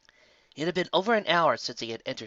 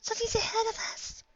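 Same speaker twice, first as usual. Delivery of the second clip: afraid; 4 dB quieter than usual. Acted. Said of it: "shaking in fear".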